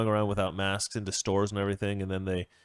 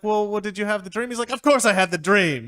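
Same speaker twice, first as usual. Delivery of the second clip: sketch argument; 9 semitones higher, 6 dB louder than usual. Acted.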